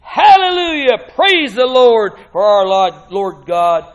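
loud, stretched shout of praise; exclaiming praise. Acted. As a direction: thunder out the hallelujah.

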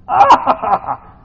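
long, loud, shaking ah; hammed-up yell of pain; mock pain cry. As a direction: cry out as if the cramp hit. Acting out cramp pain.

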